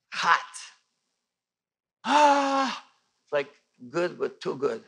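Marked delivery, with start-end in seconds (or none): 2.0-2.9 high, loud gasping cry of "Ah"; overwhelmed cry. Retold or imitated. Retelling being struck by God's lightning, overwhelmed cry. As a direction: cry out as the heat floods in.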